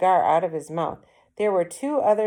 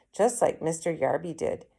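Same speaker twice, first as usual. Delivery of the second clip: ordinary delivery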